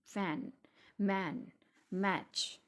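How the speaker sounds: फैन, मैन, मैच with a long, slow vowel; strong vowel. Shown as a demonstration. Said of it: "vibration है वो ज़्यादा लगी थी". (फैन, मैन, मैच में खिंचा हुआ ऐ)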